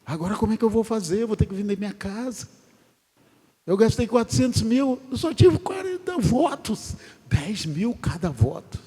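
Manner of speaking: high, fast panicked pleading; mock desperation. Candidate who spent four hundred thousand, hammed-up fast high panic. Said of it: "Ele estava desesperado".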